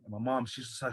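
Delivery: normal talking voice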